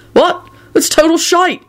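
high-pitched indignant snap; snapping. A narrator's reading. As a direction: snap back, voice shooting up. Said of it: "George snapped".

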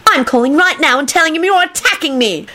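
high, indignant threat to tell; angry squeal. A narrator's reading. Rosie threatening to report Kenny, squealing angrily. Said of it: "Rosie squealed angrily".

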